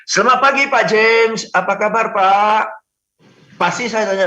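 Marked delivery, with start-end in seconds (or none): 0.1-2.6 higher, louder, friendly hello; friendly greeting. Shown as a demonstration. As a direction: greet him like an old friend. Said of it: "Anda bisa bilang". (sapaan ramah seperti ke teman)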